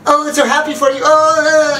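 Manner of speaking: high-pitched gushy congratulations; imitating overjoyed friends; mock gushing. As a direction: gush loudly, drawing out a high "oh". Mock-gushing friends in retold story.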